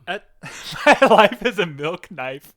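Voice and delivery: loud, slow laughing announcement; laughing title read. Shown as a demonstration. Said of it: "this sounds like a good sitcom".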